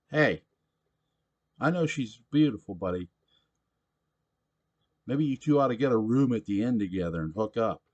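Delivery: put-on loud, high rowdy drunk voice; a belligerent barfly demanding his beer; drunk pushy patron. Sam teasing Simon.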